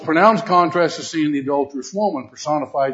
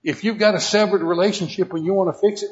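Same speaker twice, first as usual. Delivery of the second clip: normal talking voice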